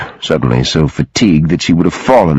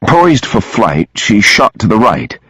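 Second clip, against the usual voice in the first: plain reading voice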